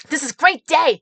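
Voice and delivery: imitated gushing, high and loud; excited supporter. Supporter greeting the candidate from his truck, excited.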